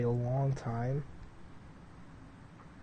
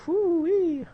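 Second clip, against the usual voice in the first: whoo-wee exclamation; 7 dB louder than usual. Real, not acted. Describing wisdom teeth pain.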